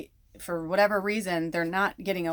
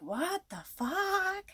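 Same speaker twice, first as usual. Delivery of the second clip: incredulous; 13 semitones higher, 5 dB quieter, much slower than usual. Retold or imitated. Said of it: "and you're like".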